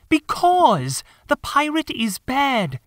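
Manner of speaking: put-on high, slow, stubborn child voice; young boy. Michael tells John the pirate is bad, slow high child voice. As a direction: spell out the obvious like a small kid.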